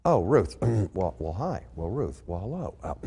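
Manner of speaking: very soft, low, stammering greeting; a startled man fumbling for words; flustered. Acted. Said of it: "he tries to get his composure back".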